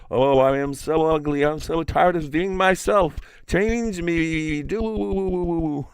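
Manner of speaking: high, voice wobbling from patting his lips; vibrating trickster voice. A narrator's reading. Iktomi begging the peacock to change him, high wobbling plea. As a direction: pat your lips and plead in a high fluttery voice. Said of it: "shouted Iktomi, patting his lips".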